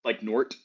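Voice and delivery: Nort with a hard t, no th; dropped th. Shown as a demonstration. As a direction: say Nort, clipping the th to a t. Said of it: "stop saying T Hs".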